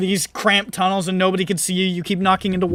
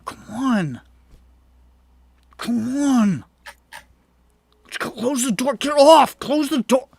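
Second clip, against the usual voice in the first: impatient elevator urging; 6 semitones higher than usual. Retold or imitated. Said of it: "you're like".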